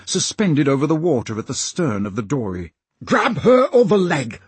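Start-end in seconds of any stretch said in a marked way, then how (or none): 3.0-4.4 high loud order; yelling. A narrator's reading. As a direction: yell at the oarsman to grab her. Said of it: "Slank yelled".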